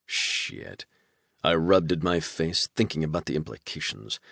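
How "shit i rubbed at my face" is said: ordinary delivery, nothing marked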